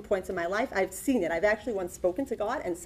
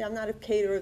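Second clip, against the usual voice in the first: usual speaking voice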